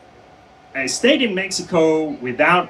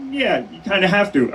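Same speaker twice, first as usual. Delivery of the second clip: reluctant confirmation; 7 semitones higher than usual. Retold or imitated. Official telling a detainee he has to sign, reluctant. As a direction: imitate an official gently breaking it to someone.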